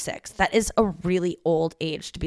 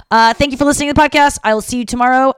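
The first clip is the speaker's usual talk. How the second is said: higher, louder, faster cheerful thanks; upbeat sign-off; 6 semitones higher, 9 dB louder, faster than usual. Real, not acted. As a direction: burst out the closing thanks like a host. Podcast sign-off, said twice.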